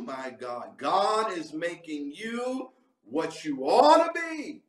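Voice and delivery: usual speaking voice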